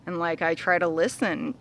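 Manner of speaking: ordinary talk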